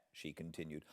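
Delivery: plain reading voice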